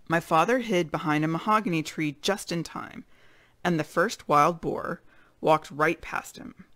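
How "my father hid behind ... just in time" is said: ordinary delivery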